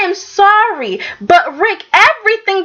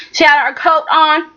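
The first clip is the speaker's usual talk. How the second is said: ordinary delivery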